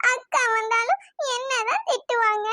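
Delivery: crying, plaintive complaint; tearful. Acted. Daughter fears a scolding from her sister, tearful. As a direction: whine it out through tears. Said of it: "அழாதடி".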